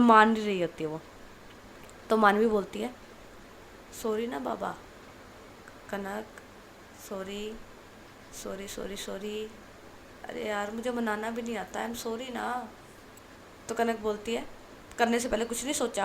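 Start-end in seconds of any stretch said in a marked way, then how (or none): 4.0-12.7 hushed, coaxing apology, softer; soft pleading sorry. A narrator's reading. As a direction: gently beg forgiveness, quietly. Coaxing upset friend with sorries. (धीमी आवाज़ में मनाते हुए sorry)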